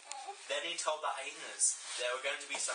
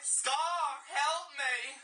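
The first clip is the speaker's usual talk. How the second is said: calling for help, higher and louder; 10 semitones higher, 4 dB louder, much slower than usual. Acted. Mufasa calling to his brother for help, louder. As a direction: cry out for help from the stampede. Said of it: "he called out".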